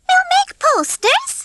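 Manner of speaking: very high, slow, dim hen voice; puzzled hen Babs. A narrator's reading. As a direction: ask it slowly in a high, baffled voice.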